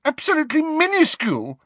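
very high, fast scornful exclamation; frantic, high-pitched sarcasm; hysterical sarcasm. A narrator's reading. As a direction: rattle it off in shrill mockery. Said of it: "with a kind of hysterical sarcasm".

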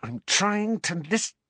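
tense, high-pitched hiss; exasperated hushed urgency; urgent hiss. A narrator's reading. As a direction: hiss it impatiently, straining to hear. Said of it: "Harry hissed".

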